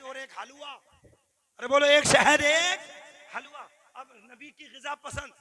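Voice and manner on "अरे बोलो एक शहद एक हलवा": loud prompt to the congregation; raised preacher's shout; rousing call-out. Real, not acted. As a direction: call out for the crowd to answer. Calls on congregation to respond.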